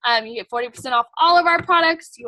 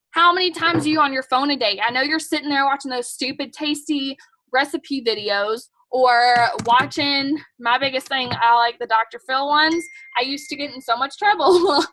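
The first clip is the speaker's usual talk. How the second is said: ordinary talk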